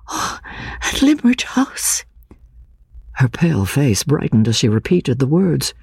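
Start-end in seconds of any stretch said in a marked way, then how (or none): 0.1-2.0 put-on high, hushed and slow, a nervous young woman; startled young woman. Anne to the stranger, hushed, high, startled. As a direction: breathe the name back in wonder. Anne repeats the house name.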